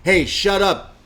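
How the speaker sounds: loud, high, slow taunting of the crowd; mock heel yell. Wrestling fan retelling a heel promo, mock yell at the crowd. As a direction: bellow it like a heel insulting the town. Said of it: "she's then yelling at the crowds".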